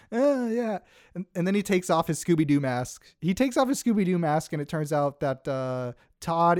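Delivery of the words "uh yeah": high, hesitant sheepish yeah; sheepish caught dad. Retold or imitated. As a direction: mumble like you've been caught out. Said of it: "To which he's like".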